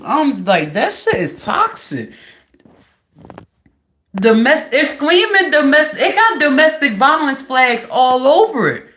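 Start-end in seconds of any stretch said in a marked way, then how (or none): none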